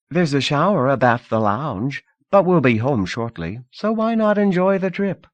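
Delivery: put-on breezy rich girl offering comfort; Lida, young hostess.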